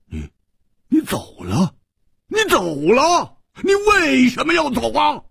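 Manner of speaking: stammer, then cry out very high; incredulous high-pitched yelling; shouted outcry. A narrator's reading. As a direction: erupt in disbelief, voice shooting up. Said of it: "胡铁花大叫".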